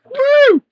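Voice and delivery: high loud shocked whoop; hit hard crying out. Real, not acted. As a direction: yelp it out after a jolt. Reacting to the shock.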